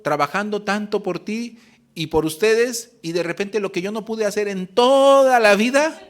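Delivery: builds from a grumble to a loud, high-pitched rant; mother's angry outburst. Retold or imitated. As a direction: voice the mother's rage rising to a shout. Mother's outburst retold, voice climbing. (la madre, herida con los hijos, pasa de la queja a gritar con rabia)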